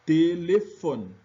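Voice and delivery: "téléphone" said slowly, the final O open and clear; slow teacher model of "téléphone"; slow French demo. Shown as a demonstration. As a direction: stretch out "téléphone" to show the open O. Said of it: "e se pronuncia O".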